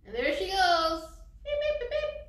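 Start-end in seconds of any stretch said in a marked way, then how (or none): 1.4-2.2 high, loud little beeps; horn beeping. Acted. Storyteller sends Teresa off in her car, high beeping horn. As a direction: toot like a small car horn.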